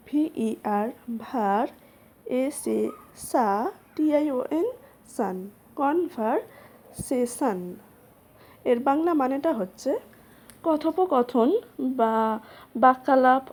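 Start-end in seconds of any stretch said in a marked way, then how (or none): 5.8-7.6 conversation drawn out very slowly, syllable by syllable; slow model pronunciation. Shown as a demonstration. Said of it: "পরের ওয়ার্ডটি হলো conversation". (খুব ধীরে শব্দটা উচ্চারণ)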